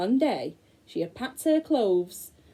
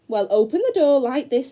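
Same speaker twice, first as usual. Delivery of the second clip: loud, higher witch showing off; witch's voice; 4 semitones higher, 7 dB louder than usual. Acted. The witch showing Gretel the oven, loud witch voice. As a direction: demonstrate impatiently like a witch.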